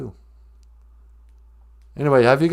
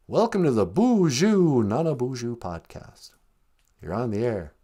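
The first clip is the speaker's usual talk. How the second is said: usual speaking voice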